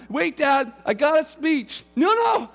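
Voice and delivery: loud, high, overlapping shouts; excited shouting. Acted. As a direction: blurt it out over the hug. Son and dad talk over each other.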